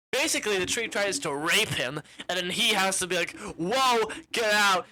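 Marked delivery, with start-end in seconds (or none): 3.6-4.9 loud mock alarm; alarmed protest. Wizard fighting off the tree, loudly alarmed. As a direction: fend it off with a raised voice. Retold escape from attacking tree.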